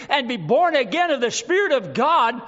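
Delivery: ordinary delivery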